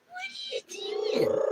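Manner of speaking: tiny, very high, hushed coo. Real, not acted. Asking the pet what it's doing, soft high baby talk. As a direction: squeak it softly to a pet.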